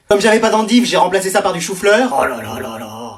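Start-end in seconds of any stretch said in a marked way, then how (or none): 2.2-3.1 low, soft groan of dread; hushed, deep dismay at bad news; dismayed muttering. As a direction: lament under your breath. Dismayed at the changed recipe.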